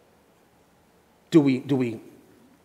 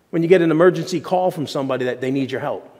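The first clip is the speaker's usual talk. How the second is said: ordinary delivery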